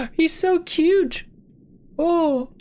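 high, slow cooing. Real, not acted. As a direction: coo over something adorable. Sees cute creature.

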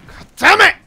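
loud, high outburst; sudden exasperated yell; frustrated curse. Real, not acted. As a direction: curse sharply at a missed shot. Missed shot outburst.

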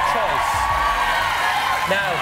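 normal talking voice

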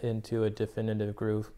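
slightly muffled lips; talking around pipe stem. Real, not acted. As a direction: pipe clenched in mouth. Seen 1: pipe stem held between lips until 4.2, then removed.

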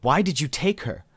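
loud, high demand building to the end. A narrator's reading. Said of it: "Ima demanded".

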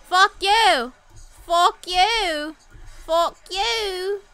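high, loud mock-British taunt; British accent. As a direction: hurl it in a playful British accent. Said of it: "my fuck yous turned British".

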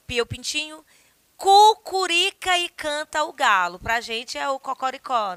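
lively poem read with animal sounds. Acted. Rereads the poem. (leitura de poema animada)

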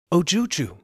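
loud, high call to a weeping stranger; exclaiming. Acted. Merchant calls out loudly to the crouching woman. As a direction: call out to her, startled and concerned. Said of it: "he exclaimed".

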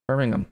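Birmingham with a weak "-um" at the end; British-style Birmingham, no "ham" in it; correct pronunciation. Shown as a demonstration. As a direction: repeat Birmingham with the ending reduced to "um".

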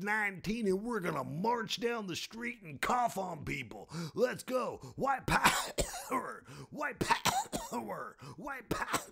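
strained squealing caricature chanting. Retold or imitated.

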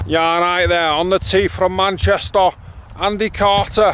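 put-on loud Manchester accent announcement; full Manc. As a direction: announce it like a Mancunian rocker. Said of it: "go full Manc. Proper, like, [name]".

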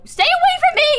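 high, loud panicked yell; shouting. Real, not acted. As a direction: scream it in alarm. Player fleeing suspect again.

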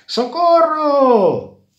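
high, loud, long-drawn cry; screaming for help. A narrator's reading. Grisela cries out while running from the bees, drawn-out scream. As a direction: stretch it into a wail of alarm. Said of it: "gritaba Grisela sin dejar de correr".

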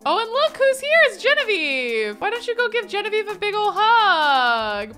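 high, loud gushing squeal; excited welcome. Real, not acted. Player spotting Genevieve at the door, excited squeal. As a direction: squeal at a friend turning up.

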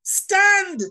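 a big high shouted stand; emphatic shout. Real, not acted. Preacher tells the church what to do, shouted. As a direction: belt out the one word stand. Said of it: "guess what you have to do?".

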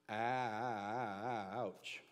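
low, long-stretched "Ouch"; drawn-out ouch. Acted. Preacher feeling the sting of his point, drawn-out ouch. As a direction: stretch the first vowel of "Ouch" way out.

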